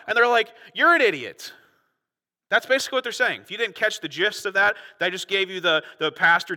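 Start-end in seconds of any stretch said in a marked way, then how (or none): 0.7-1.5 loud, high scornful put-down; dismissive mock outburst. Leaders answering Nicodemus's question, loudly dismissive. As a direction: shoot down the question loudly, pitch swooping down.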